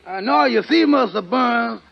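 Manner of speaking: ordinary delivery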